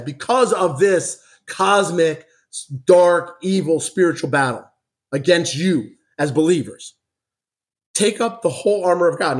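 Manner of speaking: fervent Bible reading from the pulpit; scripture reading. Acted. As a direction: proclaim the passage to the church. Reads scripture aloud to congregation.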